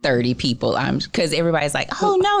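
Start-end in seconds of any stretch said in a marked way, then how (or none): 2.0-2.4 mock alarm. Retold sympathy from everybody.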